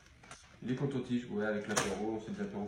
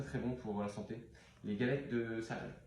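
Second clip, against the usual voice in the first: ordinary talk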